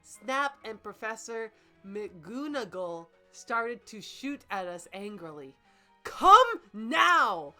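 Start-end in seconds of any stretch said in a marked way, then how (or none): none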